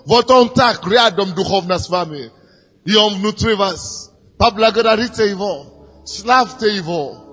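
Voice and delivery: usual speaking voice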